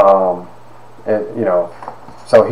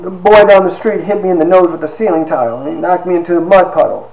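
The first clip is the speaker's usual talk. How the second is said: put-on upset little boy; 8 semitones higher than usual. Crying child tells mother.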